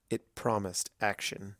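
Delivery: ordinary talk, nothing marked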